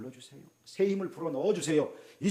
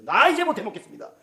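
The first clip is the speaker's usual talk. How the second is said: fed-up Elijah; 7 semitones higher, 10 dB louder than usual. Acted. Elijah quitting, voiced by preacher.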